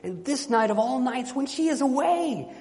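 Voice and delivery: high, impassioned storybook begging; desperate plea. Acted. Enchanted knight begging his guests for rescue, desperate plea. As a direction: sustain a high, urgent plea that peaks on key phrases.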